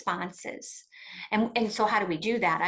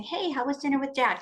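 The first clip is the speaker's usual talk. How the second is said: bright, higher sing-song greeting; forced niceness; 6 semitones higher than usual. Retold or imitated. Mother greeting her child at the door, overly nice. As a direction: put on a cheery, too-nice voice. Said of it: "instead of them coming in the door and saying".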